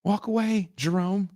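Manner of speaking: high pleading call to the screen. Retold or imitated. Calling out to the TV character.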